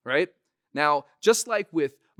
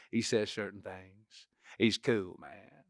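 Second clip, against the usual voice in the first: slow, low, cool drawl; Matthew McConaughey; 4 semitones lower, 5 dB quieter, slower than usual. Shown as a demonstration. Showing his mannerisms, slow cool drawl. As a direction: ease through it and fade away on the end. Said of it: "really lean into the McConaughey mannerisms".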